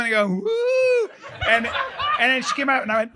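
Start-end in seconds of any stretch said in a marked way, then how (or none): 0.3-1.1 very high, drawn-out "Woo!"; mock scare hoot. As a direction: hoot high like a playful ghost. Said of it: "I was gonna scare her".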